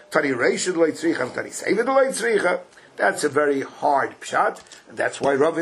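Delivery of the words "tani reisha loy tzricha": ordinary talk, nothing marked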